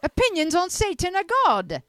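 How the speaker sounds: high, loud put-on British accent. Host doing the line Jake refused, hammed-up British accent. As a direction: belt it out as a hammed-up Brit. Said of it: "Oh, I'll do it".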